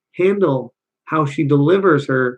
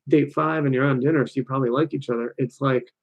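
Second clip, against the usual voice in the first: ordinary talk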